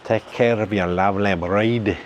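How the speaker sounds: lightly put-on Japanese accent; playful imitation of Japanese-accented English; mock Japanese accent. Husband advising men on their brides, mock Japanese accent. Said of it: "That's how they talk in, uh, Japan".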